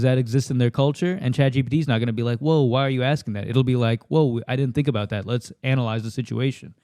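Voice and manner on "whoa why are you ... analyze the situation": ordinary delivery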